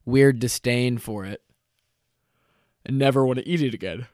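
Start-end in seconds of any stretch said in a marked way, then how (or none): 2.9-4.1 yawning. Real, not acted. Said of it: "I'm yawning on ya".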